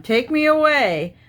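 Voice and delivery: Calgon ad line, high and loud; mock ad slogan. Reading a bubble bath ad line, hammed-up high and loud. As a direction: belt it like the old bath ad. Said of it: "there's Calgon".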